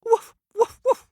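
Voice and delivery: high, loud yapping woofs; yappy barks. Acted. The kitten barking at the farm animals, high and loud. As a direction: bark like a small dog. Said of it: "said the kitten".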